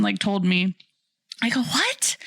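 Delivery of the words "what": high-pitched, rising mock bafflement; acted-out high "huh?" of surprise; retold disbelief. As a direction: replay a baffled yelp. Acted reaction to worker's answer.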